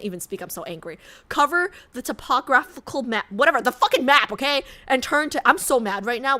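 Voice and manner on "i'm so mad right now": ordinary talk, nothing marked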